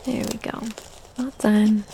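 slow, soft breathy whisper. Acted. Mother settling a sleepy child, slow hushed whisper. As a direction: hush it as if she is drifting off.

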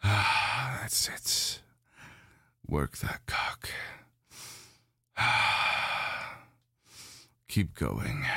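breathy sighs and moans rising in pitch; pleasured groans. Acted. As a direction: let the sighs spill out between words.

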